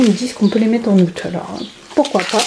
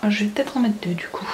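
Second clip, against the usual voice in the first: whispered aside to herself; whispering; 8 dB quieter, faster than usual. Real, not acted. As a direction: breathe it out unvoiced, to herself. Aside while making sandwich.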